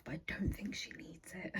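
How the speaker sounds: soft unvoiced whisper. Real, not acted. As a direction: whisper it like a secret. Whispered aside about buying more.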